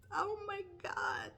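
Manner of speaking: a high, drawn-out whispered groan; exasperated whisper. Real, not acted. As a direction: breathe it out slowly in despair. Exasperated at nothing working.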